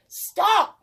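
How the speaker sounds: high, sharp panicked yell; boy desperately yelling at the runaway pot. Acted. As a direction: shout at the pot to stop. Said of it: "yelled Big Anthony".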